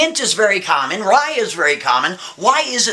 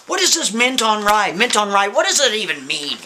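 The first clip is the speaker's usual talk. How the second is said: higher, insistent, mildly frustrated demand; a little angry; 5 semitones higher than usual. Acted. Man questioning a painting, getting a little angry. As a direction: press the question with rising annoyance. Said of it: "He starts to get a little angry".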